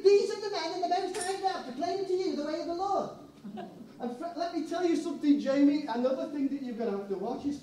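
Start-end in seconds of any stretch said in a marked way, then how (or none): 0.0-3.1 high, far-off proclaiming voice; proclaiming slave girl. Acted. Fortune teller proclaiming about Paul and Silas, high and strange.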